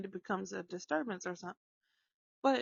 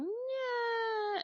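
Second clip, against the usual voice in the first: put-on much higher whiny baby wail; mock fake crying; 13 semitones higher than usual. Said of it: "starts going".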